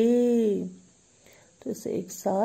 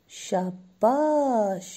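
drawn-out praise; much slower than usual. Real, not acted. Teacher praising class.

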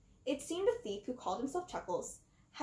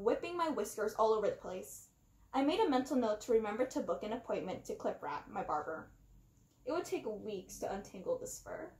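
plain reading voice

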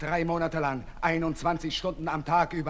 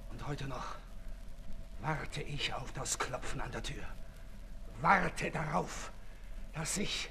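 fearful dread; 4 semitones lower than usual. Acted. Marat fears his murder.

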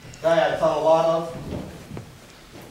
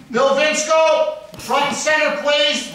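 slow, loud summons pitched higher; calling out; 7 semitones higher, 6 dB louder, slower than usual. Real, not acted. Resident demanding the absent councilman come forward, slow loud call-out. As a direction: call him up to the front, slow and booming.

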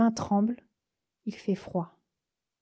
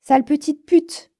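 loud, higher angry yell; furious insult; 5 semitones higher, 8 dB louder than usual. Acted. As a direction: hurl the insult after her. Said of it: "Sa voix qui traverse les fossés". (le chauffeur hurle une insulte furieuse derrière elle)

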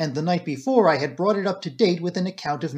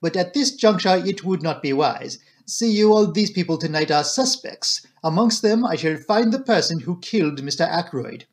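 put-on French-accented detective, firm and courteous; confident Belgian detective voice; earnest Poirot; 3 semitones higher than usual. Detective explaining tonight's suspects, earnest.

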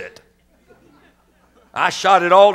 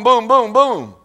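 rapid mock gunshots, louder; shotgun booms; 7 semitones higher, 5 dB louder, slower than usual. Boy firing again and again, mock gunshots. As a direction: fire off each boom.

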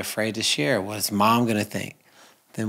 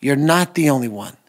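louder, higher, embracing reassurance; reassuring call; 4 semitones higher, 8 dB louder than usual. Acted. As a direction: call out to comfort them.

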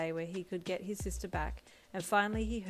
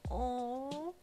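high, rising swooning "aww"; theatrical wail; 6 semitones higher than usual. A narrator's reading. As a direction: wail it as if clutching your heart. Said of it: "Brian wailed".

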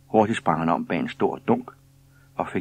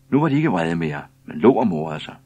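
plain reading voice

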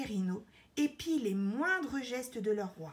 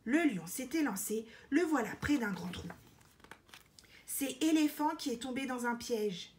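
ordinary delivery

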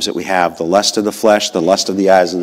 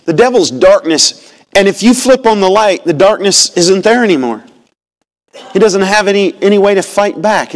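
raised, higher preaching voice, driving the point home; preaching at full force; 9 semitones higher, 4 dB louder than usual. Acted. Preacher rousing the church against the devil, preaching at full force. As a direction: preach it loud to the congregation.

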